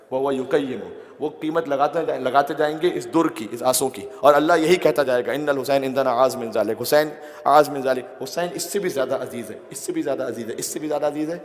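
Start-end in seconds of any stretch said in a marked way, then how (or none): 4.2-8.2 louder, fast, driving repetition; building sermon climax. Real, not acted. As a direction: hammer home the refrain. (عروج پر پہنچتی خطابت)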